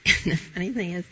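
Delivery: usual speaking voice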